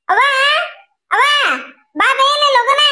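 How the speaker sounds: high, urgent calling out; yelling for mother. Acted. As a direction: holler to fetch mother quickly. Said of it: "ಇದು ಯಾಕೆ ಹುಯ್ಕಾಗತ್ತೇನೆ".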